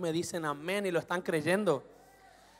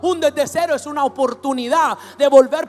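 loud, high, mounting declaration of faith; full-force preaching; 6 semitones higher, 14 dB louder than usual. Real, not acted. Preacher declaring no fear of starting from zero, impassioned shouting. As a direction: belt it out with conviction, building.

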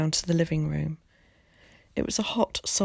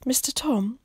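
put-on high, breathy child's voice; interrupting boy; 7 semitones higher than usual. A narrator's reading. Willie interrupting Tom's writing, anxiously. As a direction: break in timidly as a little boy. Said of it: "interrupting him".

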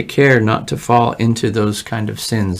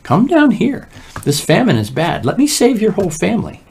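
urgent invitation; 9 semitones higher than usual. Acted. Pharaoh's invitation voiced.

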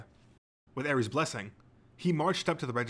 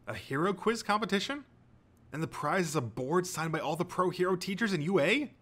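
higher, wondering hero fan's questions; Midoriya, amazed; 7 semitones higher than usual. A narrator's reading. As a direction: ask it with rising fannish excitement.

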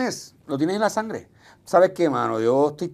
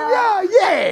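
cheer; 6 dB louder than usual. Real, not acted. Cheering the song.

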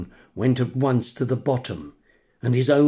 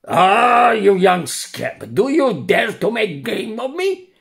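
high, loud, affronted cry; indignant outburst; 9 semitones higher, 7 dB louder than usual. Acted. The tricked buyer shouts at the puppet, indignant. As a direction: bristle at being made fun of.

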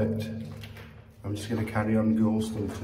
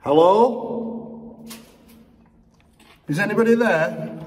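higher, louder bravado calls; calling into the dark; 11 semitones higher, 3 dB louder than usual. Acted. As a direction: shout brave threats into darkness.

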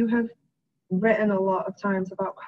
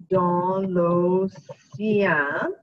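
dans l'océan said very slowly; slow dictation; 3 dB louder, much slower than usual. Shown as a demonstration. Teacher dictates slowly while writing.

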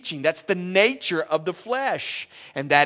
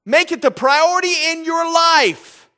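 loud, high, emphatic sermon delivery; fiery preaching; 9 semitones higher, 10 dB louder than usual. Acted. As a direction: drive each word home to the congregation. Preacher exhorts congregation.

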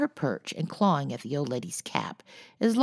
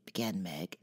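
usual speaking voice

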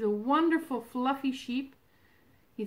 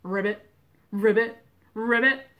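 playful frog ribbits, getting louder; frog croak; 9 dB louder than usual. Pretend. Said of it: "What does your frog say?".

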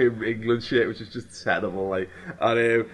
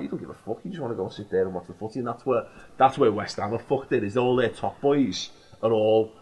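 ordinary talk

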